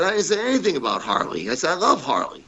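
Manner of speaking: high-pitched, hurried denial; mock defensive insistence; retold protest. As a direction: act out pleading your innocence. Denying the accusation to Fuji.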